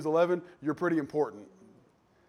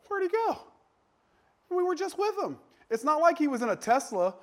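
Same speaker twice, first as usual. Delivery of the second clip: very high-pitched, baffled question; bewildered; 11 semitones higher than usual. Acted. Family acting out shock at Dad vanishing, bewildered. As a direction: squeak it out in acted disbelief.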